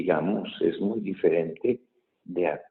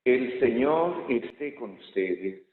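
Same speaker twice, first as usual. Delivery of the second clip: slow, raised intoning; solemn liturgical greeting; 7 semitones higher, slower than usual. Real, not acted. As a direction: intone it to the congregation.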